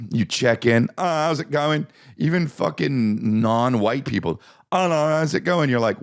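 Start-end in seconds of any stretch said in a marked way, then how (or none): 1.0-1.8 high-pitched British greeting; British accent. Retold or imitated. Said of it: "this accent's just for everybody". 4.7-5.7 high-pitched British greeting; British accent. Retold or imitated. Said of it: "this accent's just for everybody".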